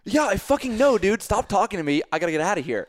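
high, loud, irritated snap back; exasperated outburst. Retold or imitated. Bleeding swimmer brushing off the dog walker, exasperated. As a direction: act out losing patience with a stranger.

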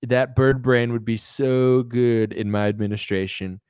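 slow, savouring mock admiration; hammed-up politician wooing a rival; Trump impression. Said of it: "He's like".

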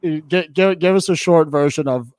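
usual speaking voice

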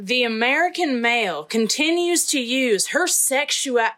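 put-on thick Texan accent, measured read; Southern accent. Co-host reads an activist's interview quote, thick Southern accent. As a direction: read it like an outspoken Texas woman. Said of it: "give me your best Southern accent".

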